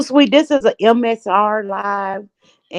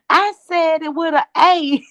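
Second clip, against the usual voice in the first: high-pitched mimicry of an insistent caller; 9 semitones higher than usual. Co-host retelling a panel caller's protest, high mock-insistent imitation.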